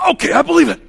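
high, loud, strained blurt; mock forced belief. Student pretending to force belief in two being odd, mock straining shout. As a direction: blurt it out as if forcing it.

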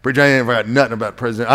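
low, quick muttered objection; lightly voiced grumbling listener; church member objecting. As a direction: voice a congregant's quiet protest, fast. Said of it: "I know you're thinking".

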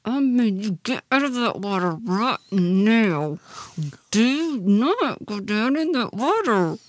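loud, aggravated mom through gritted teeth; gritted-teeth scolding. Retold or imitated. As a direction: force out each word through clenched teeth, slowing for the final warning. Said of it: "I'll grit my teeth".

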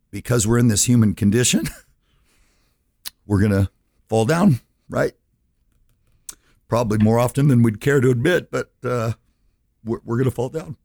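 emotional. Real, not acted. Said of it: "I get a little emotional".

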